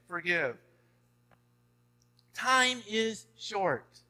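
rising pastoral urgency; impassioned preaching. Real, not acted. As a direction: plead with them to do right now. Preaching climax continues.

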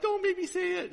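put-on high, whining little-girl plea; whiny child pleading. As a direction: beg like a reluctant kid. Daughter begs her father, mimicked.

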